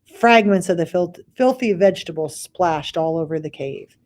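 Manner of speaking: ordinary delivery, nothing marked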